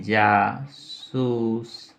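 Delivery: Jasoos drawn out as "jaa-soos"; slow dictation. Shown as a demonstration. Teacher writing what spy means, slow syllabic dictation.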